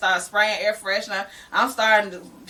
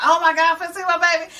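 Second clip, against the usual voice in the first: high, eager little squeal, a bit louder; retold excitement; 7 semitones higher, 4 dB louder, faster than usual. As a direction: gush about seeing him soon. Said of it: "I was just like".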